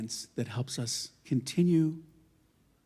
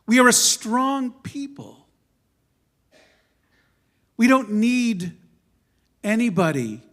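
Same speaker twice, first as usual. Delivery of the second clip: forceful speech; 7 semitones higher, 8 dB louder than usual. Real, not acted. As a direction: stand tall and proclaim it. Proud declaration of strength.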